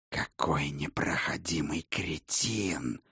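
soft, slow venomous hiss. Acted. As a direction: hiss the words under your breath. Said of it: "прошипел вампир".

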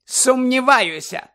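high, loud, drawn-out doubt; forceful scornful retort; cutting retort. A narrator's reading. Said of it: "відрізала вона".

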